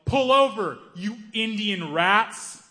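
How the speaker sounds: road-rage shout; angry yell. Retold or imitated. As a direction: shout it out the car window at them. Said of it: "he rolled down his window and he yelled".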